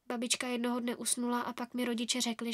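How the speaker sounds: plain reading voice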